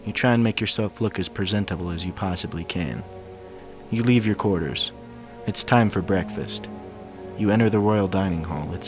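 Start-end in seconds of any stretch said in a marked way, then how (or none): none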